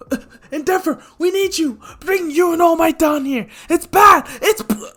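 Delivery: high, loud panicked caller voice; a frantic voice on the phone, cut off; urgent caller. Acted. Caller begs Endeavor for help, high panicked voice.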